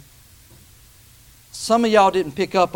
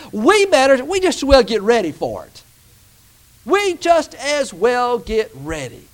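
full-force preaching; 6 semitones higher, 6 dB louder than usual. Real, not acted. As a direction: drive the warning home from the pulpit.

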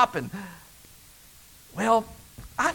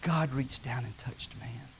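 whisper; 8 semitones lower, 11 dB quieter than usual. Acted. Awed moment in sermon.

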